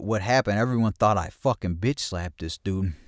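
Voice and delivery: ordinary talk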